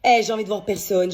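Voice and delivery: normal talking voice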